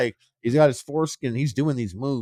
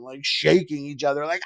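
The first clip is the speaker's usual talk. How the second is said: normal talking voice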